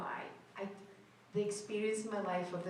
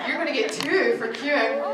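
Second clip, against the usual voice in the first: loud, bright teasing of the waiting fans, higher; playful loud teasing; 4 semitones higher, 12 dB louder than usual. Real, not acted. Actress teasing fans in the queue, loud and playful. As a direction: call out a joke to the queue. Joking loudly with fan queue.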